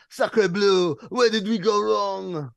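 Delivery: mock French despair, a bit slow; French accent. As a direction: ham up the French gloom.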